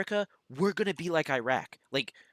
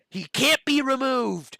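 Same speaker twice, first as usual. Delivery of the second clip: slow emphatic; 7 semitones higher, 6 dB louder, slower than usual. Real, not acted. Ranting about DeJoy.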